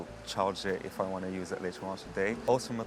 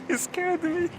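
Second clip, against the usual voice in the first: high laughing voice; 4 dB louder, slower than usual. Real, not acted. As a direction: laugh it out, still startled. Laughing after a dog startled him.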